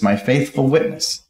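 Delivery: usual speaking voice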